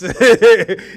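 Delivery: short, loud burst of laughter. Real, not acted. Joking about Bulger as informant, loud laugh. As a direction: crack up loudly for a moment.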